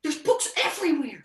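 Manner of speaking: very high outraged shout; furious yell. Acted. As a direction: demand who did it. Angry at spilled books.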